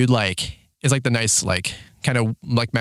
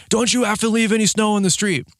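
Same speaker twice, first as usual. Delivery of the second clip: mock yelling, pitched up high; retold angry yell; 9 semitones higher than usual. Irate man challenges plow driver, high mock yell. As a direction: imitate the angry complainer in a high voice. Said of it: "yelling at me about plowing".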